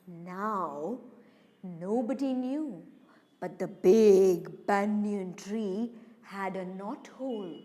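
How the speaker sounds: low, hushed narration with long pauses; ominous storytelling. A narrator's reading. Storyteller revealing the hidden snake, low ominous hush. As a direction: drop low and soft, drawing out the menace.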